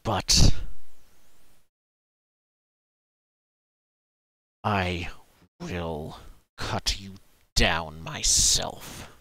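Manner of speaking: low, slow threat with long pauses; menacing vow. Acted. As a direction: vow it word by word. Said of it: "and he says".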